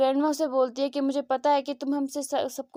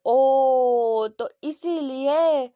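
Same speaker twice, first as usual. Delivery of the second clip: long drawn-out, louder "oh"; slow, exaggerated "so that's why"; dawning realization; 3 dB louder, much slower than usual. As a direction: stretch it out as the penny drops. Acted drawn-out realization in retold story.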